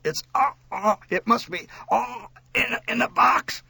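high, strangled gasps; gasping. A narrator's reading. Child answers mother through a choking collar, gasping. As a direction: choke the words out bit by bit. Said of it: "I managed to gasp out".

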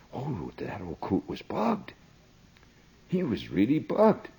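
ordinary talk

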